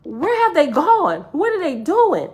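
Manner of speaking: high, drawn-out retold disbelief; bewildered questioning. Dreamer wondering where the others went, bewildered questioning. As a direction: ask it high, utterly baffled.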